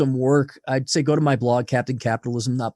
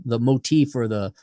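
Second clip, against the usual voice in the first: usual speaking voice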